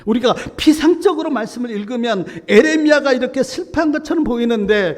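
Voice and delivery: loud, high sermon climax; full-force preaching. Real, not acted. 설교자가 회중에게 크고 높게 힘주어 설교. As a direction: preach it out to the congregation.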